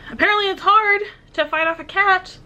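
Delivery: breathless and high; laughing off being winded; out of breath. Real, not acted. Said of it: "I don't know why I'm winded".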